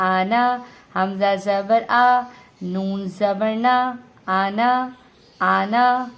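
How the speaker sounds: آنا with its last vowel not drawn out; unstretched ending. Shown as a demonstration. Teacher shows the exception, آنا read without stretching the alif. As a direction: spell slowly, then say آنا without lengthening نا. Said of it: "اسے ہم کھینچیں گے نہیں".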